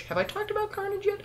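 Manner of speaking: way up high, squealing the question; a giddy fan squeak; squeaky excited. Real, not acted. Fan gushing to viewers about Carnage, in a high squeal.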